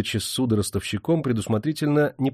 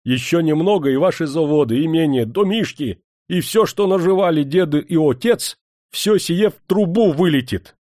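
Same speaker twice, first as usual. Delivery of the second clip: high, heated warning; flaring up; 6 semitones higher, 4 dB louder than usual. A narrator's reading. Steward warning master that his estate will be ruined, flaring up. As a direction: build to an exasperated outburst. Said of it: "пуще вспылил управитель".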